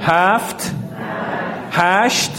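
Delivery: teacher counts aloud for the class; loud group count. Shown as a demonstration. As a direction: call each number out to the class. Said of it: "همه با هم با صدای بلند".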